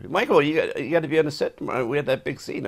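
high, fast pleading insistence; exasperated director. Retold or imitated. Director insists the actor return for tomorrow's scene, exasperated. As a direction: press him hard, he must be on set. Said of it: "so he's exasperated".

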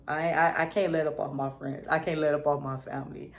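usual speaking voice

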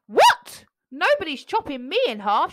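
very high, loud shriek. A narrator's reading. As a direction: shriek in alarm. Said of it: "shrieked the beanstalk".

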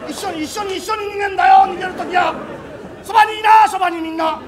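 high, loud panicked cries; frightened shouts to the others, don't run off alone; panicked shouting. Acted. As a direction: yell in terror to stay together. Frightened ghost-story shouting.

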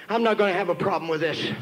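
low, restrained mock annoyance; retold indignant retort. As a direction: answer back low, as a miffed father. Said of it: "He made me mad when he said that".

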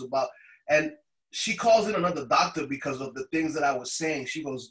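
normal talking voice

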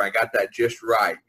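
normal talking voice

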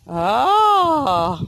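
ordinary talk

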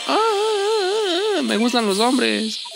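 very high "ah", then slow "me gustan los hombres"; goofy falsetto. Acted. A high squeal and slow joke about a muscular boss.

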